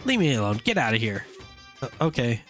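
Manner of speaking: normal talking voice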